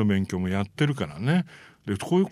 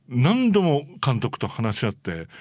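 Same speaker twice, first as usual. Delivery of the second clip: usual speaking voice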